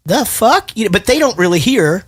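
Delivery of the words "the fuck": high, incredulous American; mock baffled American. Americans reacting to mixed accents, mock baffled. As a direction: squeak it out like a baffled Yank. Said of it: "all Americans would be like".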